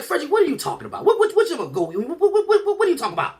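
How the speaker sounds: very high, stammering disbelief; imitated incredulous mother stuck on her words; flabbergasted mother. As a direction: sputter as a baffled mom, pitch way up. Bewildered mother questions her son.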